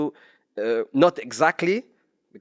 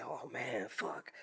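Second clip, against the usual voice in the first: very soft muttered curse; 19 dB quieter, slower than usual. Retold or imitated. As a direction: swear under your breath. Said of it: "I say".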